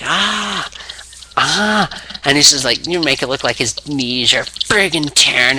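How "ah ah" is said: high pained cries; mock pain cries. Austin trapped in the leg hold, pained yelps. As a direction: let out a wrestler's pain yelps. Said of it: "Austin's like".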